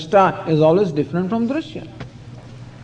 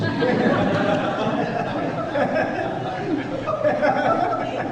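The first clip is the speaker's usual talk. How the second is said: breathy, rising burst of laughter; long laugh; 6 semitones higher, 7 dB quieter than usual. Real, not acted. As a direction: laugh freely at your own joke. Laughs at own punchline.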